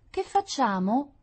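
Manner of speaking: high, slow, drawn-out whine; whimpering. A narrator's reading. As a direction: whine like a helpless little girl. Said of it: "piagnucolò Rita".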